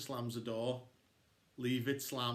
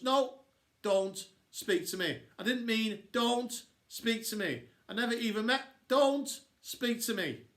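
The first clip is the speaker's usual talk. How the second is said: she grows more furious with each repeat; rising angry girl; 8 semitones higher, 5 dB louder than usual. Acted. Florida upset, repeats angrily.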